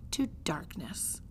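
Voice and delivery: whispered, slow and ominous; dark whisper. A narrator's reading. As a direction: let it sink into a whisper. Ominous end of chapter.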